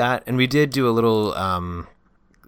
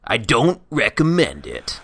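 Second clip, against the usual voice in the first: slow mock announcer; 3 semitones higher, 4 dB louder, slower than usual. Slow catchphrase.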